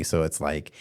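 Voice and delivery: ordinary talk, nothing marked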